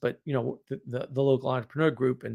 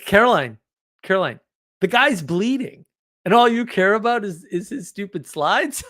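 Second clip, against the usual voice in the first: incredulous outburst; 7 semitones higher, 5 dB louder than usual. Retold or imitated. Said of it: "And I was like".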